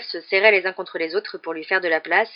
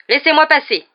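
pompous, higher and louder command; self-important; 8 semitones higher, 9 dB louder than usual. A narrator's reading. Percy pushing through the crowd, self-important and loud. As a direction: push through like he owns the place. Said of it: "en prenant des airs importants".

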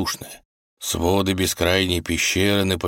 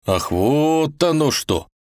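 realizing; 8 semitones higher, slower than usual. Acted. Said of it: "закивал Иван".

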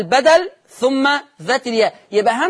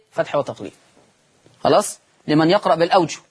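ordinary talk

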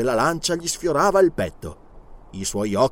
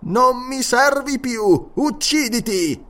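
raised mesmerist voice; 10 semitones higher, slower than usual. Acted. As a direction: order death like a hypnotist. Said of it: "alzando leggermente la voce".

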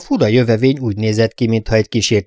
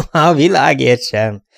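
laughing; 6 semitones higher, 5 dB louder, slower than usual. A narrator's reading. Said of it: "nevetett a fiatalember".

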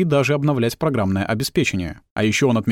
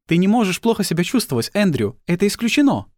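Mrs Jurgensen; 7 semitones higher than usual. Acted.

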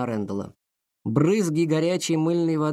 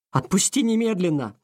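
furious demand; 6 semitones higher than usual. A narrator's reading. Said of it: "чуть ли не шипя от ярости".

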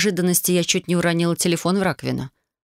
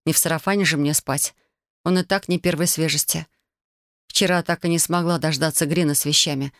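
plain reading voice

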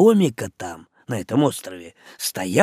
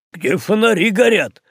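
loud, higher blurt; 6 semitones higher, 4 dB louder than usual. A narrator's reading. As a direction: burst in unexpectedly. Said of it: "влез неожиданно боцман Чугайло".

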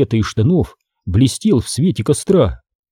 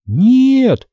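very high-pitched cry of denial; high, mild shout; Эмили crying out; 12 semitones higher, 3 dB louder than usual. A narrator's reading. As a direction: cry back in a girl's high voice. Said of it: "закричала в ответ Эмили".

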